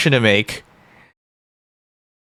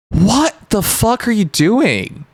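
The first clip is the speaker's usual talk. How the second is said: much higher, a slow, drawn-out what the fuck; incredulous outburst; 10 semitones higher, slower than usual. Real, not acted. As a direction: let the disbelief stretch the words out. Yelling at a game character.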